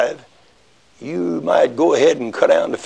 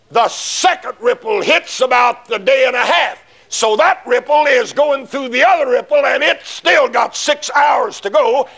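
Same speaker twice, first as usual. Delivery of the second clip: preacher pressing the point hard, voice raised; fervent preaching; 11 semitones higher than usual. Acted. Preacher warning the congregation, raised fervent preaching.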